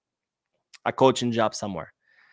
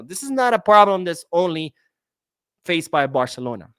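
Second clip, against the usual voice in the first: normal talking voice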